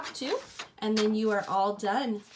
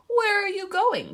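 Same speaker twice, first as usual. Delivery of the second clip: growling wolf; 10 semitones higher, 8 dB louder than usual. Acted. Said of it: "growled the wolf".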